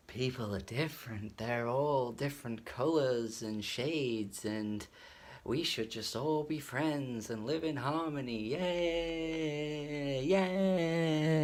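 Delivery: sugary, mock-naive sermonising ending in cheers; mock preachy. Reviewer mocking the preachy song, sugary hammed-up cheer. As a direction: parody a saccharine message song. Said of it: "where it's like".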